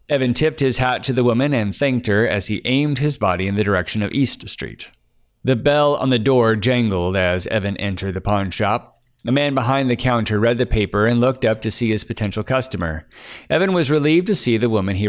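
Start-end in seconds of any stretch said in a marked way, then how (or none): none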